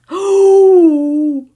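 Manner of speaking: loud, high drawn-out "oh"; gasp, then let out a loud oh; real thrilled shock; astonished gasp. Real, not acted. Startled by what the chart shows.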